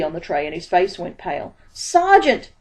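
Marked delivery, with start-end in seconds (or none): 1.7-2.5 high, loud call across the street; urgent shout, pitched high and loud. A narrator's reading. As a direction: shout to stop him in time. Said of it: "he shouted".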